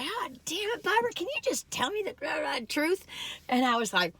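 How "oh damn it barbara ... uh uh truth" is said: put-on much higher, impatient old aunt voice; blunt old aunt. Great aunt scolding Barbara on ferry, high and impatient. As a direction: mimic her exasperated great aunt. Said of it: "She's like".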